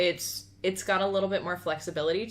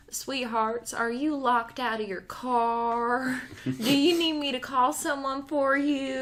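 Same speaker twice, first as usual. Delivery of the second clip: slow, higher Southern drawl; southern accent; 4 semitones higher, slower than usual. Retold or imitated. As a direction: draw it out like a concerned Southern lady. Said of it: "I gotta do a southern accent".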